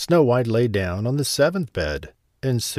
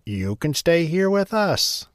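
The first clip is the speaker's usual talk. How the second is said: put-on kindly old dwarf; 7 semitones higher than usual. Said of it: "said the oldest dwarf".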